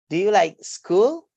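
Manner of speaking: "Do you like school" slow, rising on SCHOOL; rising intonation. Shown as a demonstration. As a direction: draw out the question, lifting "school" at the end. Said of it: "a rising intonation".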